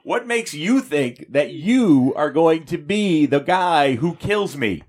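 high, smug gloating hero. Retold or imitated. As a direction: gloat like a cocky superhero. Superman gloating at villain.

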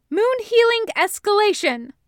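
high, loud attack call. A narrator's reading. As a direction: call out the spell with force. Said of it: "as she calls for".

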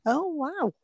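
a high retold gasp of amazement; surprised. Retelling own reaction to historian.

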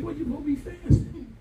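high incredulous pastor question; mock disbelief. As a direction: needle the kid, half laughing. Pastor mocking question to kid.

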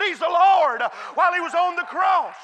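loud, high preaching shout; climactic shout. Real, not acted. Preacher shouting to the church at the climax. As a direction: throw it to the back pews.

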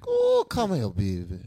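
swooping, mock-seductive Louisiana accent; Louisiana woman's accent. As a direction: playfully mimic a flirty Louisiana woman. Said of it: "a woman from the boot talk to you".

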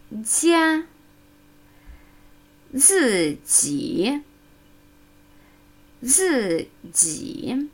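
"Zai" with a hard z, "jia" with a soft j. Shown as a demonstration. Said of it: "mit harten Z und weichem Z".

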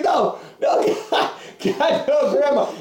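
whispered, laughing pleas; breathy mock begging. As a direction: gasp it out in half-laughing terror. Pinned man begging.

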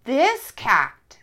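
loud and proud; loudly. Acted. Kata answering the ducks, loudly. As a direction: announce it to the ducks. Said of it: "said Kata loudly".

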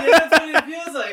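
talking through laughter; laughing. Real, not acted. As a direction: laugh out the words.

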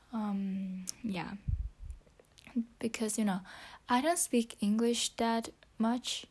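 ordinary delivery

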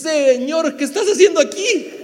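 John caught off guard seeing Jesus; startled John. Retold or imitated. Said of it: "le dice".

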